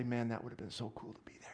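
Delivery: whispered longing. Acted. Pastor voicing a Bible reader's wish in a whisper. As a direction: breathe it like a secret wish.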